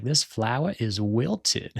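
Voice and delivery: slow, drawn-out put-on Cajun drawl; Cajun accent. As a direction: imitate an affected Louisiana Cajun accent. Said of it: "Cajun accent".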